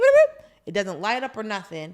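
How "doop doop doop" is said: high, loud beeping doops; door beeps imitated. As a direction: mimic the keypad tones. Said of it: "supposed to go doop".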